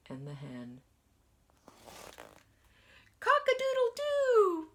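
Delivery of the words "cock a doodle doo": loud, very high rooster crow. Acted. Teacher crowing like the rooster for the children.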